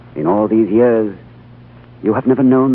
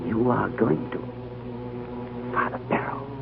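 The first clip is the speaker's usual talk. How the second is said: stunned, breathless whisper, very slow; hushed disbelief to the dying old priest; shocked whisper; 12 dB quieter, much slower than usual. Acted. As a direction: barely voice it, as if the news knocked the air out of you. Told the High Lama will die.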